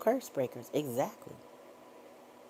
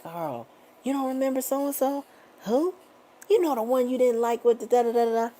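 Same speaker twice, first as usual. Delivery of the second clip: imitating her sister; 7 semitones higher than usual. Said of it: "she'd be like".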